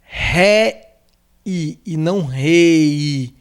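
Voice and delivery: ré-i split into two slow syllables, then rei; very slow ré-i versus rei. Shown as a demonstration. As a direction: spell out ré-i slowly against rei. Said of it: "aí está escrito".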